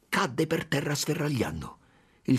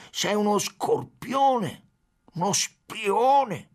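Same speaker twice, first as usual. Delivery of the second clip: much higher, slow, drawn-out insult; slow furious shout; 9 semitones higher, much slower than usual. A narrator's reading. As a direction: drag out each word with contempt.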